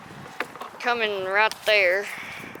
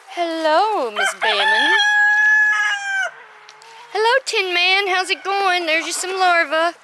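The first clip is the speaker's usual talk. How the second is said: high, louder cooing greetings; pet talk; 9 semitones higher, 6 dB louder than usual. Real, not acted. Greeting her chickens, high coo. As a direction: greet the birds like babies.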